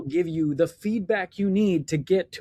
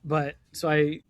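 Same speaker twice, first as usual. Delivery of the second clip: ordinary talk, nothing marked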